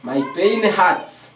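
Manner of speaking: slow, careful demo phrase; slow model phrase. Shown as a demonstration. Teacher drilling the correct phrase slowly for students. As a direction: spell out the right phrase slowly. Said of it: "c'est ça ce que tu devrais dire".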